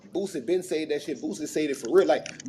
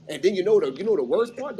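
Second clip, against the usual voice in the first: normal talking voice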